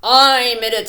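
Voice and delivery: loud bold bone; bone's boast. A narrator's reading. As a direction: announce it proudly. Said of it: "said the bone".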